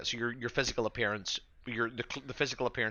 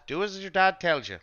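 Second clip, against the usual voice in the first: raised, louder parental order; dad laying down the law, pitched up; scolding father; 10 semitones higher, 8 dB louder than usual. Acted. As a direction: cut off the child's protest firmly. Father scolding his protesting son.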